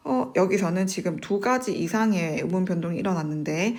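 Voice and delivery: ordinary talk, nothing marked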